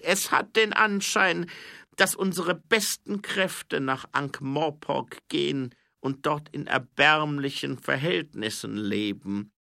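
high, loud, slow king with a grievance; gekränkter Zwergenkönig. A narrator's reading. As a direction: spell out old insults pointedly, high and loud. (hoher, lauter König, langsam und vorwurfsvoll)

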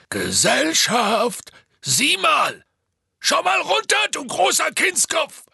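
high, loud, drawn-out hollering; Hagrid. A narrator's reading. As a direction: shout up to get a giant's attention. Hagrid shouting up for attention. (lautes, gedehntes Brüllen nach oben)

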